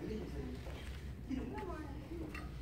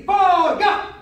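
loud, high called count; drill command; 5 semitones higher, 9 dB louder than usual. Real, not acted. Coach calls the fourth strike, loud command. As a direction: fire off the count to the class.

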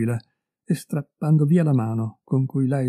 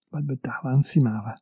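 usual speaking voice

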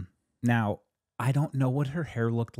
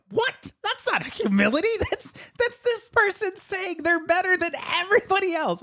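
incredulous scoff. Retold or imitated. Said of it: "I'm like".

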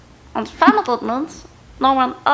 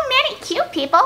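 very high cartoon girl voice; high-pitched cartoon superhero girl; Bubbles; 10 semitones higher than usual. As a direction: squeak it like a cartoon little girl. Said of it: "your favorite Powerpuff Girl".

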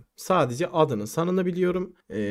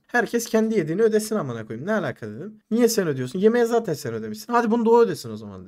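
retold argument, higher pitch; heated objection; 7 semitones higher, 4 dB louder, faster than usual. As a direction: argue the point in mock indignation. Retold quarrel over the bill. (tartışır gibi, yüksek perdeden itiraz)

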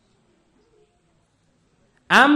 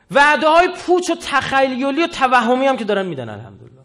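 louder, higher sermon voice; preaching at full force; 5 semitones higher, 7 dB louder than usual. Acted. Cleric denouncing empty promises, preaching at full force. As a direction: drive the point home to the crowd.

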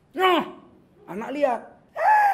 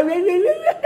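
high, loud squawks of mock rage; hammed-up loud, high-pitched fussing; mock angry squawking; 12 semitones higher, 9 dB louder than usual. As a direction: imitate a shrieking, nagging parent in a fit. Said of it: "Bapak paling marah".

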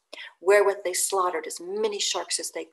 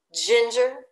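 louder, higher rising "Ginger?"; indignant; 4 semitones higher, 6 dB louder than usual. Acted. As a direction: fire "Ginger?" back in outrage. Stubb's rising outrage.

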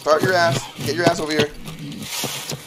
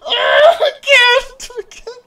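laughing shout; 4 dB louder, much slower than usual. Real, not acted.

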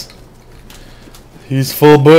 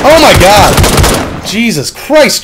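startled shout; 7 semitones higher, 6 dB louder, much slower than usual. Real, not acted. Startled by gunfire nearby.

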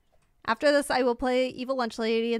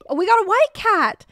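surprised; 9 semitones higher, 11 dB louder than usual. Real, not acted. Surprised by a new cat.

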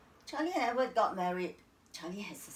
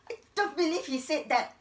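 loud, higher-pitched denial; vehement disbelief; 5 semitones higher, 6 dB louder than usual. Acted. Wife denying her dying husband rejected her, vehemently. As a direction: throw the claim back forcefully.

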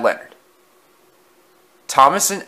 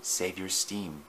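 much softer, slow undertone; an old engine quietly warning a friend; muttered; 4 semitones lower, 14 dB quieter, slower than usual. A narrator's reading. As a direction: keep it low so he can't hear. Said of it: "he muttered".

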